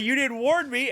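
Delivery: high-pitched retold protest; mock accusation. Son blaming Mom for not warning him, high mock-wounded protest.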